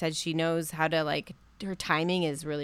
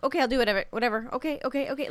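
quick string of higher mock agreement; mock compliance; 7 semitones higher, much faster than usual. Podcaster imitates stylist giving in to his partner, mock compliance. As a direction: lightly imitate someone giving in, higher pitched. Mimicking Ben's eager compliance.

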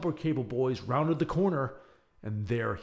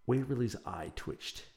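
ordinary delivery

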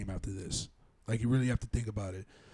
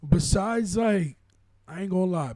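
raised pitch, loud and fired up; excited rant; 6 semitones higher, 8 dB louder than usual. Real, not acted. Heated rant about the Bronx.

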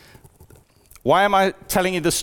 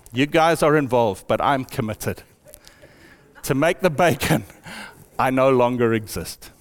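solemn pig; 5 semitones lower than usual. Acted. Preacher voicing the pig in a farm parable, low and grave.

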